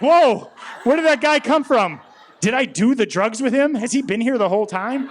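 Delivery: high, loud and slowed panicked whoa; startled panic. Retold or imitated. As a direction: jump out of your skin mid-thought. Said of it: "And I was like".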